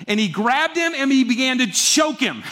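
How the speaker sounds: forceful storytelling; heated narration. A narrator's reading. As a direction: let the outrage rise. Telling the violent choking.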